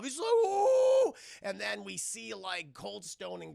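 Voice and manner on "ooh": high, loud drawn-out "ooh" howl; goaded howl. Retold or imitated. Retelling Cold Stone being goaded, loud high howl. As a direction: imitate a character roused to attack.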